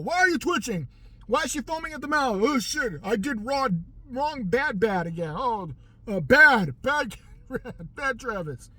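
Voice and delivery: hammed-up panicked scolding; mock scene. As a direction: scold him like a bad dog. Acted scene, scolding a dog.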